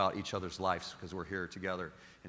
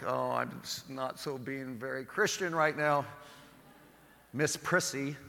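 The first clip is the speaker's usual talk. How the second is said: higher, slow put-on snark; sarcastic inner aside; 4 semitones higher, slower than usual. Priest mocking the prissy passenger, slow and higher. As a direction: sneer it at Miss Prissy in your head. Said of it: "And so I'm like".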